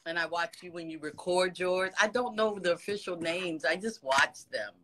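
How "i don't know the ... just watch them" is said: ordinary delivery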